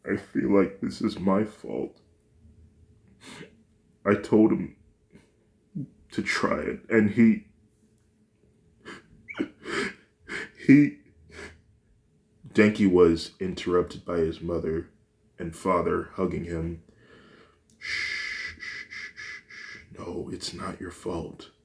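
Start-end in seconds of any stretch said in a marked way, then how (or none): 0.1-11.0 rising into a tearful wail; boy sobbing that it was his fault; crying. Acted. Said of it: "Denki can't stop crying". 17.8-21.5 hushing. Acted. Parents hushing crying child.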